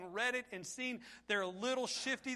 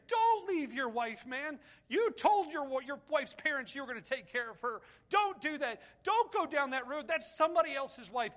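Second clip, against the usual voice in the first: loud, higher, urgent pleading; 5 semitones higher, 6 dB louder than usual. Retold or imitated. Pastor pleading with a young man, urgent and loud. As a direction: plead with him not to leave his wife. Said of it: "I have pleaded with people".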